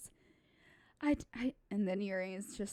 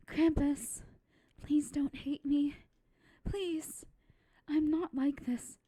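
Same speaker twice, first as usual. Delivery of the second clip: put-on pleading shy girl; 7 semitones higher, 5 dB louder than usual. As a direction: beg him not to hate her.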